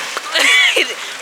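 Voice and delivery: high shrieking laugh. Real, not acted. Vlogger backing out of the jump, high shrieking laugh.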